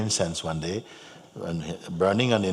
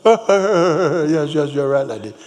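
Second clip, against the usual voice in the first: loud, high mock agreement; crusty man conceding; 7 semitones higher, 8 dB louder, slower than usual. Jamaican man concedes myrrh is incense, loud agreement. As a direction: give in noisily, starting high. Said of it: "Just like this".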